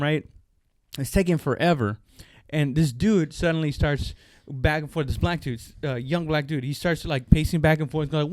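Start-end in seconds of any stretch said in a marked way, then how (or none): none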